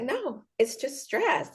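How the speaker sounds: dismissive nurse. Retold or imitated. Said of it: "she's like".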